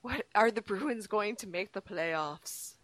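soft, slow mystical question; mock fortune-teller. Host asking the crystal ball about playoffs, hushed and mock-mystical. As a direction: ask the oracle slowly and softly.

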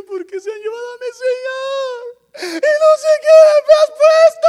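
loud, very high-pitched crying; mock wailing. Preacher acting out weeping Mary answering the angels, wailing. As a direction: wail it like an over-the-top weeper. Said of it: "No soy muy chillón".

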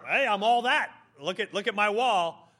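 brash mock bragging; boastful worker. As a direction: crow proudly about your own work.

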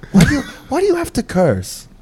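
high-pitched mock mom nagging, not really yelling; scolding mom impression. Son mimicking his mom's complaints about cursing on the podcast, mock scolding. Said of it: "my mom yells at me".

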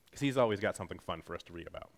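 ordinary talk

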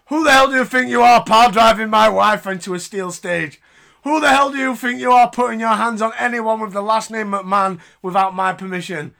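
hammed-up furious father-in-law; imitating angry Vince. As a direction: bellow it like an outraged tycoon. Voicing angry Vince McMahon.

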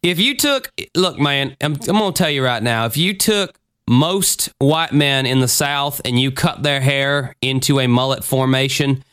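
put-on Southern good ol' boy twang; folksy mock-Southern accent. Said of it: "you get a Southern accent all of a sudden".

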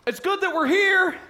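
high, loud eager blurt; eager disciple blurting nonsense; blurting Peter. Retold or imitated. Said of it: "Dynamite saying, Peter".

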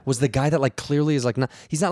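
ordinary delivery

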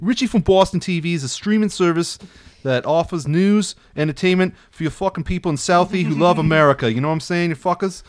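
lightly put-on Boston accent, reading an ad. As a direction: read the FAQ like a Boston local, only a light accent. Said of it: "You have to read it in a Boston accent".

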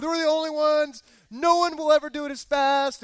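loud, high summit bragging; hammed-up triumphant boast; boastful celebrating. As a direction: crow it out like unbeatable champions. Boasting resumes after aside.

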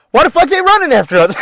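high, loud panicked yell; retold panicked shout. Brother fleeing fifty angry girls, yelling in panic. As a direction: shout it while running from the mob.

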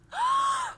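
high startled gasp; loud shocked gasp; sharp gasp. Acted. GM voicing startled naiad.

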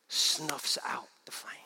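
slow, breathy whispered warning; slow solemn whisper; hushed whisper. Real, not acted. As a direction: let the words go out like a candle. Solemn sermon point.

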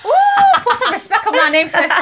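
a high squealed Ooh, then a loud laughing demand; sassy laughing demand. Real, not acted. Teasing her friend, she squeals and laughs out the demand. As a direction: demand respect through laughter, hamming it up.

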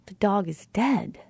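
slow, "dead" stressed with falling pitch. Shown as a demonstration. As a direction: land firmly on "dead" at the end. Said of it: "depending on what word we are influencing".

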